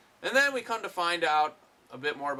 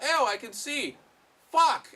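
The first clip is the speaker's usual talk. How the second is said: high, loud startled shriek; shocked yell; 11 semitones higher, 6 dB louder, slower than usual. Retold or imitated. Blind father suddenly seeing Samara, shrieking. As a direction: blurt it out in sudden horror.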